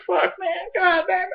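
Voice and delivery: high, strained half-crying rant; mock choked-up outburst. Fan retelling his own teary reaction, high strained mock sobbing. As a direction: whine it like choking up over the scene. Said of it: "I was like".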